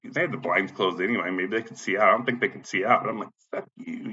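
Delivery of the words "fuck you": very soft, voiceless mock curse; whispered curse. Showing how quietly he cursed at the office, whisper. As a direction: whisper the insult under your breath. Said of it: "I'm just like".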